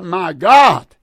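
loud, anguished calling out to God; the Son calling out from the cross, loud and anguished; anguished cry. Acted. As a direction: cry it out, forsaken and pleading. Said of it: "the Son cried out".

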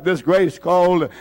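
normal talking voice

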